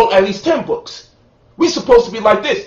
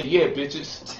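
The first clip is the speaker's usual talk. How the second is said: low, soft smug brag; smug taunt; 6 semitones lower, 9 dB quieter than usual. Retold or imitated. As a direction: gloat while showing off new shoes. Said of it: "I'm gonna show you my new shoes and say".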